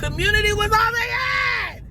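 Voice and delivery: high indignant cry; passionate shout. Real, not acted. Heated rant about community.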